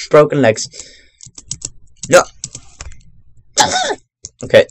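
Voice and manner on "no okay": usual speaking voice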